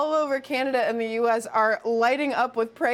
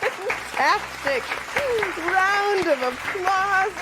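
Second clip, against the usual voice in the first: slow, high, drawn-out celebration; real delight, stretched out; excited cheering; 8 semitones higher, much slower than usual. Real, not acted. Celebrating hole in one.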